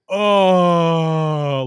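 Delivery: a loud drawn-out dismayed "Oh"; crowd groan. Retold or imitated. Bar crowd seeing Takeo Spikes hurt, loud dismayed groan. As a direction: groan like a whole bar watching a player go down. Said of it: "everyone in McFadden's went".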